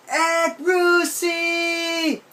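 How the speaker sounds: put-on a six-year-old stuck in a tree yelling for his aunt; childish yell. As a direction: call for the aunt from up a tree, stretched out and high. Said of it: "I yelled at the top of my lungs".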